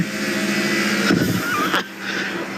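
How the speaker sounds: airy, high-pitched laugh of disbelief; a stunned, breathy cackle after the finish; breathless laugh. Real, not acted. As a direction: let out a soft, wheezing laugh.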